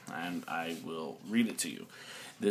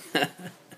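short laugh; 9 semitones higher, 5 dB louder than usual. Real, not acted. Laughs at own joke.

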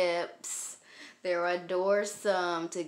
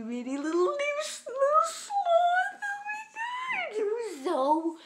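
very high squeal over the cute sloth; high cry-squeal of delight. Real, not acted.

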